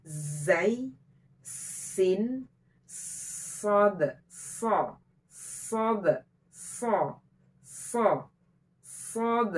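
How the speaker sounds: "Zai, sin, sod" with the sibilants pressed into a long, clear hiss; drawn-out, sharp sibilant opening each of "Zai, sin, sod". Shown as a demonstration. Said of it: "Dijelaskan desisnya, ditekan".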